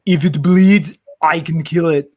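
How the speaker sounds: hammed-up Austrian action-hero accent; movie one-liner in a mock German accent; bad German accent. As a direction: do a bad Austrian tough guy. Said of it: "lots of really bad German accents".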